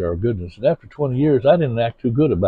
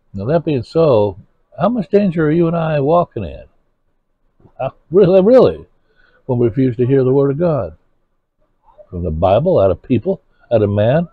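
louder, high urgent preaching; preaching at full force; 5 semitones higher, 4 dB louder than usual. Acted. Warning the congregation against refusing God, urgent loud preaching. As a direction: press the danger on them hard.

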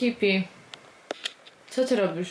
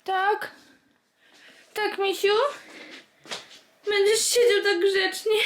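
high tender coo; 7 semitones higher than usual. Real, not acted. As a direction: coo to the wrapped puppy. Cooing to dog. (wysokie, czułe gruchanie)

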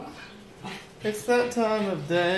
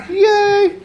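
much higher, louder squealing praise; 14 semitones higher, 8 dB louder than usual. Real, not acted. Owner squeals with joy as her dog barks, much higher. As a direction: cheer the dog on in a high squeal. Owner cheering dog's barking.

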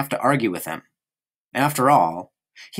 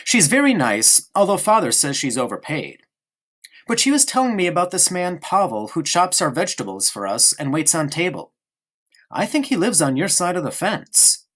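put-on lightly raised boy's voice, chatty; a small boy prattling on to his friend; eager child voice, higher; young boy Bruno; 7 semitones higher than usual. Narrator voicing young Bruno.

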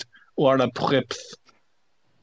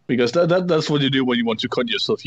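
ordinary talk, nothing marked

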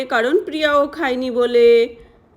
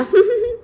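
sneaky giggle; 9 semitones higher than usual. Acted.

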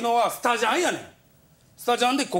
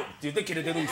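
normal talking voice

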